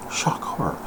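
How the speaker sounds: whispered, mock-horrified aside. To his viewers, a whispered mock-horrified aside. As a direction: hush it like a scandal. Mock gasp at own news.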